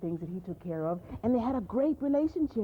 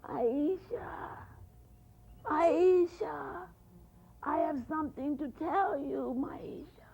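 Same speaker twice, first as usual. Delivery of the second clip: higher, drawn-out spooky calling; old woman in the forest calling to Maisha; eerie old woman; 6 semitones higher, much slower than usual. Acted. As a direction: call her name like a ghost in the woods. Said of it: "the old woman said".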